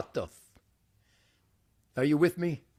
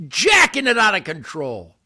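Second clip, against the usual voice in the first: usual speaking voice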